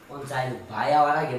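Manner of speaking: low-pitched, unemphatic ভাই hour আগে; low aside. Acted. Man to sister-in-law before brother returns, low aside. First take, before emphatic repeat.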